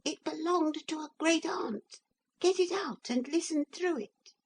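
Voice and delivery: very high, fast tiny voice; small piping far-off voice; teeny tiny voice. A narrator's reading. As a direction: rattle it off like a tiny toy man. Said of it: "teeny tiny voice say".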